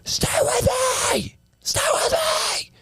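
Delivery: high, shouted plea; desperate shout. Acted. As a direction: yell at him to hold on. Shouting at dying James.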